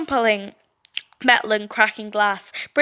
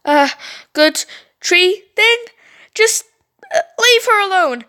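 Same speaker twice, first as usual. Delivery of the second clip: nervous coaxing; 9 semitones higher, 5 dB louder than usual. Acted. Stacy nervously talks the creature down, high. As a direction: coax the monster timidly.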